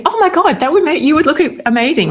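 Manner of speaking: high, fast gushing praise; a squeal of delighted praise; gushing excitement. Retold or imitated. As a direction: burst out with how amazing she'd look. Gushing to sister about bangs.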